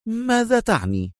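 high, startled question; astonished. A narrator's reading. Consul hears of the city governor's part, astonished. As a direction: ask in amazement, pitched right up. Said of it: "سأله القنصل في دهشة".

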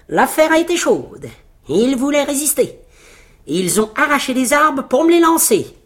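high, proud boasting; boastful little tailor. Acted. Little tailor tells the riders of his victory, boasting.

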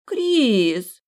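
put-on rejected girl pouting at Kris, sulky whine. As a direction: pout and say the name reproachfully. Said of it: "надула губы бантиком и насупила брови".

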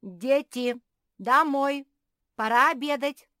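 high, loud shouted summons; mother's ringing call to come in; calling the children. Acted. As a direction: holler out to children far away. Mother calls children from the window.

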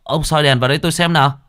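loud, high alarmed call; startled shout. Acted. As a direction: bark out in shock. Cậu Tẩy startled, shouting. (quát lên hoảng hốt)

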